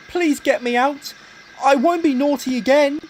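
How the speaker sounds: high pleading little engine. A narrator's reading. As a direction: beg to be let out.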